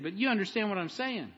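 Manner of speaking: ordinary talk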